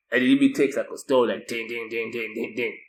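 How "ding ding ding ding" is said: ordinary talk, nothing marked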